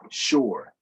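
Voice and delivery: ordinary delivery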